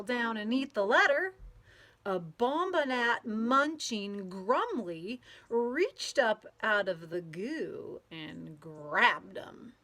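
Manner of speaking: lively storybook read-aloud with playful sound words; reading to children. A narrator's reading. Teacher reading a picture book to children, lively and playful. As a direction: read it out to a group of kids, bouncing along.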